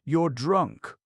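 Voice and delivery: put-on a crafty old man's sneer. A narrator's reading. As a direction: sneer it down at her.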